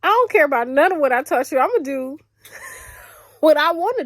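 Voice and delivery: fast loud mock mother voice; imitating mom. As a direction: play the defiant mother, loud and quick. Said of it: "my mother has now just been like".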